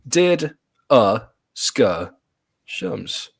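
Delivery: "Did a ska shums" drawn out very slowly, pitch climbing high; goofy pronunciation. Shown as a demonstration. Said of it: "Here's the pronunciation".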